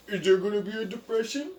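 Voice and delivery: high, loud mock whine; mocking whiny voice. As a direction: whine it like a clueless person. Mocking clueless people's question.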